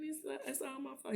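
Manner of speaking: soft-edged, high mock crying voice; imitated tearful confession. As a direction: retell it as if close to tears. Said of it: "He's crying".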